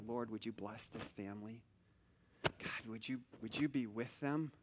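praying softly while passing the intensive care rooms; hushed prayer. Retold or imitated. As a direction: pray under your breath for a stranger's family.

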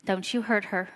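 lightly voiced serious warning; serious minister. Minister warning the groom privately, a hint of sternness. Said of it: "he says to him very seriously".

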